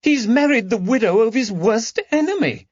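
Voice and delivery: high, rising distress; the news cuts her. Acted. Lady Caroline telling her friends, rising in distress. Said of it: "voice rose with distress".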